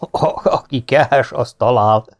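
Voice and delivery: laugh, then stretch the words through it. A narrator's reading. Marquis amused at the girl's ghost hunt, laughing. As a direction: chuckle into a slow start. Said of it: "A Márki elnevette magát".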